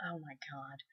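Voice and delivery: very soft, voiceless exclamation; embarrassed whisper. Real, not acted. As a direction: whisper it to yourself. Embarrassed after telling story.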